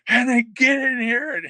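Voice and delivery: high, loud, drawn-out summons; imitated yell. Boss yells at the prankster over the mice, imitated yell. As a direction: mimic an angry boss yelling someone in. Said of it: "he's yelling for somebody".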